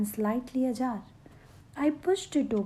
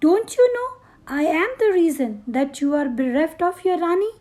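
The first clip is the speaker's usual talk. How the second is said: much higher and louder, a fast furious outburst; furious shout; 5 semitones higher, 7 dB louder, faster than usual. A narrator's reading. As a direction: peak of her rage, hurling the blame at herself. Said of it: "Her voice was rasping, harsh, and growing louder".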